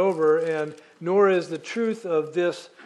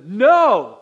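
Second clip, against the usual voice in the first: loud, high, drawn-out no; God's emphatic refusal; 4 semitones higher, 12 dB louder than usual. Acted. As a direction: cut in as God with a big, booming refusal. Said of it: "God stops and says".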